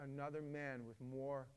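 normal talking voice

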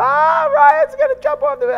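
high, loud squeal of alarmed classmates; a hammed-up shrill chorus of alarmed classmates; mocking high-pitched friends. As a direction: shriek it like panicked kids.

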